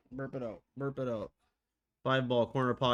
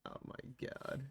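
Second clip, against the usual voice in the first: whispered; 13 dB quieter, slower than usual. Real, not acted. Shocked whisper.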